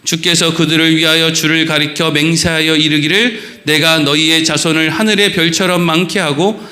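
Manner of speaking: formal pulpit scripture reading. Acted. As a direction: read the passage with solemn weight. Reads scripture aloud.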